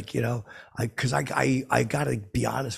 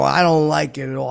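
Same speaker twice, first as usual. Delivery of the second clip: loud, higher retold protest; worked-up objection at high volume; a little emotional; 4 semitones higher, 12 dB louder than usual. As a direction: burst out upset, much louder. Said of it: "I got, like, a little emotional".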